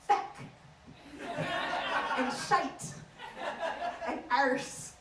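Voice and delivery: Irish-accented swear words, set apart. Shown as a demonstration. Comic listing Irish swear words, in Irish accent. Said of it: "Irish is also quite good for swearing".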